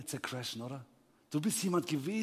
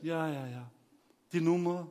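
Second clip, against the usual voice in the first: unhurried calm; 4 dB quieter than usual. Retold or imitated. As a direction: brush them off unbothered. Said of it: "Ganz ruhig erstmal". (seelenruhige Schalterbeamtin)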